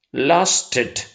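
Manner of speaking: "lasted" with the ED said as a separate "id"; ID ending. Shown as a demonstration. Teacher giving an example of ED after T, ID ending. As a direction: say "lasted" in two syllables, ending in "id". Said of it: "we pronounce id".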